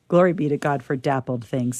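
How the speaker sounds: usual speaking voice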